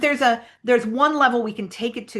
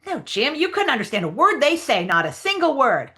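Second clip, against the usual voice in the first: high, insistent character voice; Huck Finn; 7 semitones higher than usual. Acted. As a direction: set them straight in a higher voice. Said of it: "read the Huck Finn lines".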